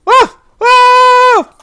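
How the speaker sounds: shrill loud cartoon scream; high panicked scream. Jim Carrey fleeing Droopy, loud high scream. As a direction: scream like you're fleeing a hound. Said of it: "He's just screaming out loud".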